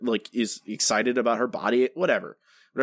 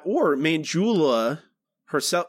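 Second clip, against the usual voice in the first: usual speaking voice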